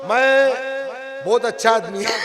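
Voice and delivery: loud, ranting campaign speech; politician's speech. Acted. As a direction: promise the voters, shouting from the stage. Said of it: "मोहल्ले के corporator".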